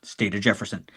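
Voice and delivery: low announcer bit. Retold or imitated. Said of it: "lean back in".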